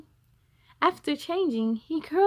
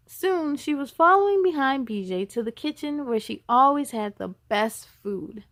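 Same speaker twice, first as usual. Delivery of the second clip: ordinary delivery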